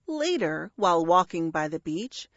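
ordinary delivery, nothing marked

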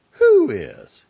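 Jenny defending her friend, high and loud; indignant. A narrator's reading. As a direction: bristle and fire back. Jenny bristles at insult.

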